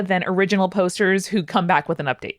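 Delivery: normal talking voice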